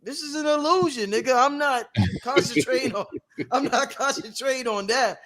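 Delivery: lightly voiced, high-pitched God; God's dismissive reply. God answering screaming humans, lightly acted dismissal. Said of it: "God's like".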